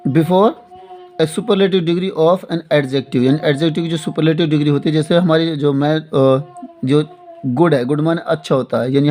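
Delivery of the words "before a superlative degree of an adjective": very slow, word by word; dictation pace. Shown as a demonstration. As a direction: give them time to write each word. Teacher dictating the rule again. (धीरे-धीरे लिखवाते हुए)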